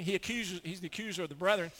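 ordinary talk, nothing marked

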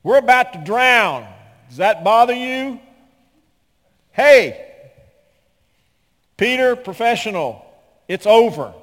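hammed-up shouting, very high and loud; over-the-top frantic bellow; mock panicked whiners. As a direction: yell the complaint as if drowning. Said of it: "So here come the whiners".

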